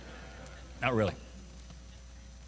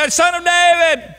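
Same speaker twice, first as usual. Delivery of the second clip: high, loud, desperate plea for mercy; crying out loudly; 10 semitones higher, 8 dB louder than usual. Acted. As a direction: cry out to be heard over the crowd. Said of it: "he cried more loudly".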